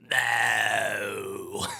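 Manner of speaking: low, drawn-out gravel "no"; gravelly mummy. Acted. Mummy answering the Uno player, long gravelly growl. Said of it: "He goes".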